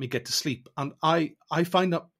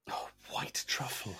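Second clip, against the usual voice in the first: dreamy hush; 3 semitones lower, 14 dB quieter, slower than usual. Real, not acted. Savouring the thought of truffle.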